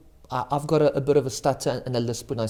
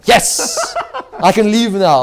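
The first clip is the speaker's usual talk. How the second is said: laughing; 5 semitones higher, 9 dB louder, slower than usual. Real, not acted. Joking about leaving the show.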